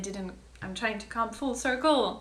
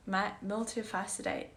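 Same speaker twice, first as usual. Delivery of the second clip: ordinary talk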